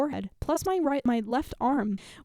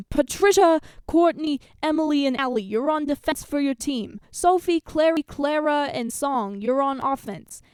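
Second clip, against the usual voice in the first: raised, higher announcing voice with bad New York accent; loud, clear coach; 3 semitones higher, 6 dB louder than usual. A narrator's reading. Coach assigning jobs to teams, loud and clear. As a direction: call out each name like a coach. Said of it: "loudly and clearly".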